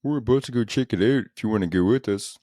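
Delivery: mock Canadian accent. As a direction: exaggerate the Canadian "oot" vowels, mockingly. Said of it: "You can't have an accent".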